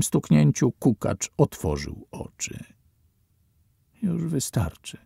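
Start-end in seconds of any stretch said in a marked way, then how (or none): 4.0-5.0 whispered. A narrator's reading. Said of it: "szepnął".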